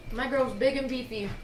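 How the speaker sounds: talking with a mouthful of carrot; mouth full. Real, not acted. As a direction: chew while you talk. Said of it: "Don't talk with food in your mouth".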